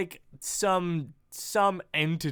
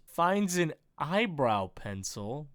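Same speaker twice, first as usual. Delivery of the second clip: ordinary talk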